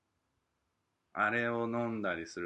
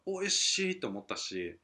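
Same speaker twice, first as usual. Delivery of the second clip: 感動の再現; 8 semitones higher than usual. Retold or imitated. 食べた時の感動を再現.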